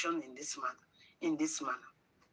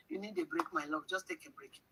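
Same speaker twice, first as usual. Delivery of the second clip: mock sweet advice; 9 semitones higher, 9 dB quieter than usual. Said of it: "When they say that, tell them".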